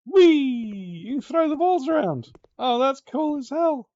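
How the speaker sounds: high, loud gleeful cheer; loud, high excited glee; delighted squeal. Real, not acted. Delighted by ball pit.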